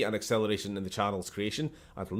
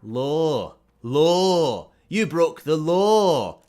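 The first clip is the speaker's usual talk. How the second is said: slow, loud Scottish brogue; Scottish accent; 5 semitones higher, 7 dB louder, much slower than usual. Shown as a demonstration. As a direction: roll out law in a broad Scottish accent. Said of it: "this is why Scottish accents are better".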